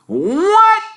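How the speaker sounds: a high, loud astonished yelp; can't believe his ears; shocked squawk of disbelief; dumbfounded. Acted. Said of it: "Ron looked dumbfounded".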